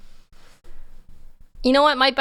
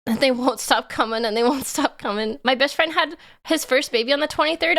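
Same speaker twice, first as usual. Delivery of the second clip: ordinary talk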